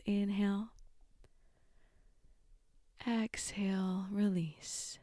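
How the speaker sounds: guided meditation. Acted. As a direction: calmly guide each breath. Leads breathing meditation.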